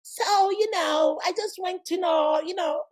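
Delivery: mock a returnee faking an American accent; fake American accent. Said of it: "American accent".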